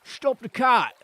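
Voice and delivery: loud Cockney shout; Cockney accent. Acted. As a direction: bark the order in Cockney. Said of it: "we're going for that accent this time".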